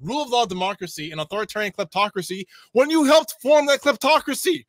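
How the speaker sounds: real outrage, pitch shooting up; outraged rant. Real, not acted. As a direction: rant at the author, fully fed up. Outraged at the article's author.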